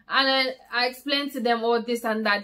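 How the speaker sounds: normal talking voice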